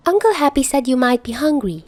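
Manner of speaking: a hint of a small girl offering; lightly voiced little girl. A narrator's reading. Morgan speaking.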